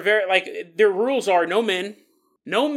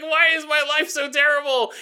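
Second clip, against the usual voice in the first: mock whine; 8 semitones higher, 5 dB louder than usual. Mock whining lonely guy.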